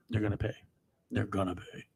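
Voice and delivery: hushed menacing warning; quiet threat. Real, not acted. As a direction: drop it low as a menacing promise. Ominous warning after shouting.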